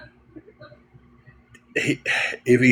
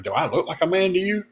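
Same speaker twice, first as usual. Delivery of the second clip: higher, offended rising question; indignant mock question; 6 semitones higher, 3 dB quieter, faster than usual. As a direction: play the insulted customer. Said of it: "he was like".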